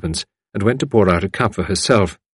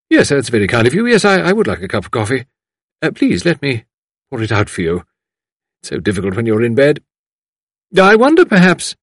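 higher, fluttery polite lady voice; fussing visitor; 5 semitones higher, 4 dB louder than usual. A narrator's reading. Visitor offering to pour coffee, politely fussing. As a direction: fuss helpfully over the invalid.

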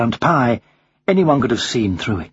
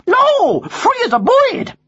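very high American parrot; reply in a high, drawn-out American bird voice; American accent; slower than usual. Acted. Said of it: "you have an American accent".

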